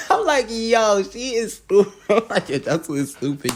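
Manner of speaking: high, loud, helpless amusement; laughing disbelief. Real, not acted. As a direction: exclaim through real laughter. Cracking up at the retold insult.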